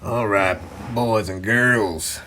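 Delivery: put-on deep, unhurried kids' show intro; children's storyteller. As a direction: announce it like a kids' TV host. Storyteller opens show to kids.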